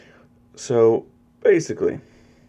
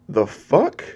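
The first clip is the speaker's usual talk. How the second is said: sharply rising, incredulous question; startled disbelief; 3 semitones higher than usual. Acted. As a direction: react baffled, pitch leaping at the end. Deku surprised at being thrown.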